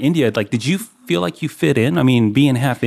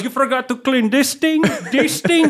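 high-pitched Indian accent impression; imitating the boss; 10 semitones higher than usual. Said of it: "And he goes".

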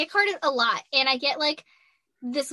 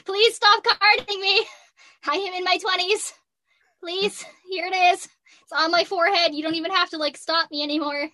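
higher and louder, weary begging; exasperated pleading; 6 semitones higher, 4 dB louder than usual. Retold or imitated. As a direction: beg the staff to stop carding you. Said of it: "I just held it up and was like".

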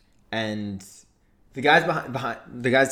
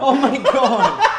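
slow, high, loud laughing gasp; laughing exclaim; 14 semitones higher, 10 dB louder, much slower than usual. Real, not acted. Friend cracking up at the coincidence, laughing. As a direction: drag it out through laughter.

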